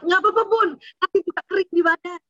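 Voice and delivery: put-on high happy child voice; a kid brushing off the worry; cheerful child. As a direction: answer like a carefree kid.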